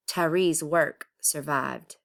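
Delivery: ordinary delivery, nothing marked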